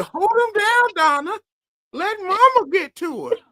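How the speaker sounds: high, loud mama voice. Retold or imitated. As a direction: command like a bossy mother. Acted mama quote, retold.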